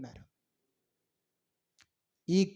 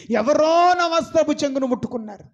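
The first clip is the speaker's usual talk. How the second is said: higher and louder announcing; Jesus calling out to the crowd; 7 semitones higher, 5 dB louder than usual. Retold or imitated. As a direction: call out over the crowd. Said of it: "యేసు ప్రభు ఏమన్నాడు".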